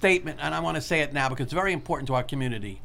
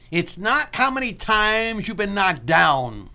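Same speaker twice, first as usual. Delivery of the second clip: slow, deliberate quote with long pauses between phrases; 3 dB louder than usual. Shown as a demonstration. Host quoting Rocky's statement to his son, slow and measured. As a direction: deliver it slowly, letting each part land. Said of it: "I'll say it slow".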